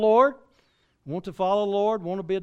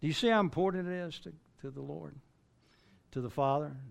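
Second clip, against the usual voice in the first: ordinary talk, nothing marked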